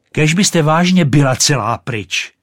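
loud, high, exasperated shout; podrážděný výkřik. A narrator's reading. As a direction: shout it at the insufferable Rocket. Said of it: "křiklo bengálské světlo". (podrážděně vykřiknout)

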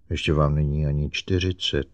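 ordinary delivery

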